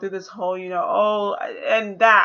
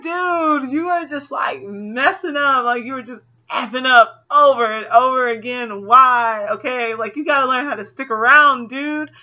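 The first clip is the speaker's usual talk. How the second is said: higher, louder, slowed, near tears; exasperated scolding; 4 semitones higher, 6 dB louder than usual. Retold or imitated. Viewer telling the dad to stick around, exasperated. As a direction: chew him out, frustrated. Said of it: "I was like".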